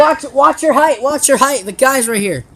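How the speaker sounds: loud, high urgent warning; shouting warning. Real, not acted. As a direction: yell the warning across. Warning a player.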